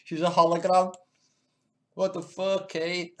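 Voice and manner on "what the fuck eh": ordinary talk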